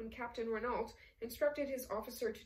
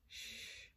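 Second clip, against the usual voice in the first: soft unvoiced shh; hushing shush; 12 dB quieter than usual. Count to Ossip as the film begins, hushing. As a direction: hush them softly.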